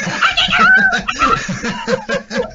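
high, laughing mock panic; panicked cry. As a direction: wail it, half laughing at yourself. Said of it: "And I'm going".

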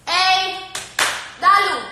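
loud call to the dog. Real, not acted. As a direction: call the dog's name sharply. Calling the dog.

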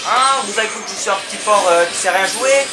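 loud, high-pitched challenge; indignant outburst. Real, not acted. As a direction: throw it at the enemies, affronted. Indignant taunt at game enemies.

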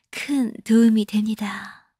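slow hushed whisper. Real, not acted. Host whispers thanks to the viewers.

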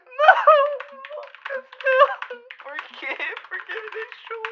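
very high, hammed-up sobbing cries; over-the-top mock weeping, voice squeaking; melodramatic wailing. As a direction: wail like an abandoned lover in a soap opera. Mock heartbreak when Lucas leaves.

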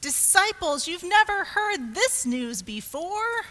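calling out. A narrator's reading. Mary calls to the disciples, excited calling out. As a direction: call the news through a door. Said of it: "called through the door".

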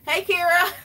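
high, loud calling out a viewer's name; excited hello. Real, not acted. Spotting a friend in the live chat, high excited call. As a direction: sing out a happy greeting.

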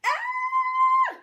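very high loud rabbit scream; long piercing shriek of a frightened animal; yell. A narrator's reading. Rabbit fleeing a roaring boy, high scream. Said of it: "the rabbit yelled".

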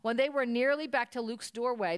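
plain reading voice

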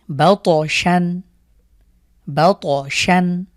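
বাতশান very slow with the ত bounced; slow qalqalah. Shown as a demonstration. Teacher slowly reciting বাতশান for students. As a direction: drag বাতশান, pop the ত. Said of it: "ধাক্কা দিয়ে পড়ব".

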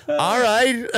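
high, laughing reply. Retold or imitated. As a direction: say it through a laugh. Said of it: "she was like".

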